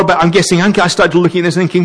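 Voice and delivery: ordinary delivery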